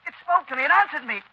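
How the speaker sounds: ordinary talk, nothing marked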